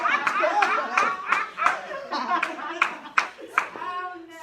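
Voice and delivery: long high loud laugh. Real, not acted. Storyteller to audience, laughing loudly. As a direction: burst out laughing at the memory.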